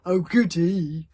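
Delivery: slow gloating thug voice; happy brute. Acted. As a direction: relish it like a bully. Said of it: "said Dog's Breath happily".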